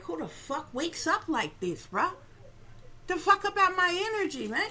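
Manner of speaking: hammed-up annoyance at waking up like this; irritated outburst. Woken by dream images, mildly exasperated outburst. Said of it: "But I'm like".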